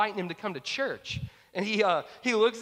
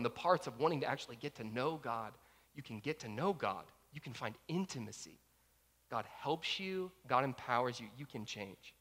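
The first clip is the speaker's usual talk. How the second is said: tender appeal; 4 semitones lower, 7 dB quieter than usual. Real, not acted. Sermon call to the congregation.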